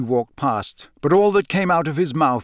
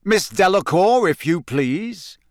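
high, swooping summons; drawn-out showman's invitation at the end; announcer calling out; 6 semitones higher than usual. A narrator's reading. Bagman summons Fleur to the arena, high and theatrical. Bagman calls the next champion.

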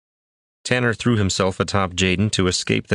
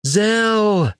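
high anguished scream; 4 dB louder than usual. A narrator's reading. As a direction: scream his friend's name. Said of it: "Tanner screamed".

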